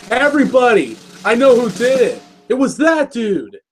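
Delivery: high-pitched, declaring proclamation; mock public announcement. Pony accusing the culprit before the people, proclaimed high. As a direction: announce it to a whole crowd.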